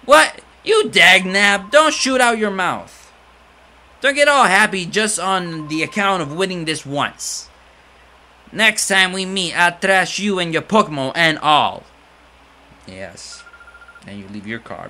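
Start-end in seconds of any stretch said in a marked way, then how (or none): 0.0-11.8 loud, high threatening rant; sore loser villain. Acted. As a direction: spit threats like a beaten crook.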